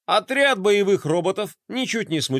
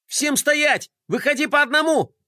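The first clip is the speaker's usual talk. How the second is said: high, sharp shouted order; commanding shout; 11 semitones higher than usual. A narrator's reading. Gloria orders everyone to freeze, shouting. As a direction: bark the raid command high. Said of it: "закричала Глория".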